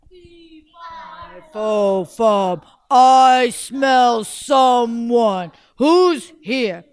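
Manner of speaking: loud, slow giant chant; booming giant. Acted. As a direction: drag out each word like a giant.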